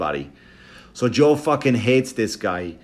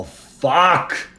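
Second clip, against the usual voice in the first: mock dismay; 5 semitones higher than usual. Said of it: "I go".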